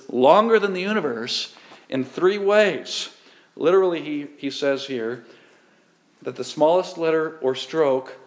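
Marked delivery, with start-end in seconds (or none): none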